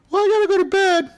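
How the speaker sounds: put-on high, quick child's voice announcing bedtime; little kid voice. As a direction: rattle it off like a young kid. Kid leaving the game.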